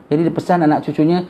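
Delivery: ordinary delivery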